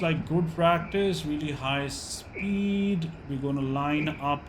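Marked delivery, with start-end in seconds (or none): none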